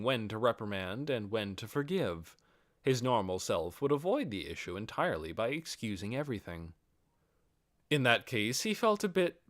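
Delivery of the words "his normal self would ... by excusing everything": usual speaking voice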